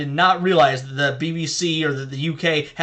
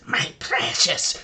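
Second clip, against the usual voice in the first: hoarse whispered hiss of Gollum; breathy creature rasp, slow; much slower than usual. Retold or imitated. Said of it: "When Gollum says".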